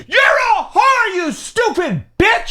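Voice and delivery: retold taunt shouted at full pitch; re-enacted yell. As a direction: holler the insult from a moving car. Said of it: "And I yell at this woman".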